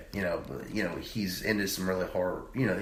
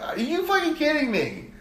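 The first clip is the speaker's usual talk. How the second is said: high-pitched incredulous outburst; exasperated disbelief. Retold or imitated. Older cousin to panicking kid, exasperated disbelief. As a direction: squeal it in retold disbelief.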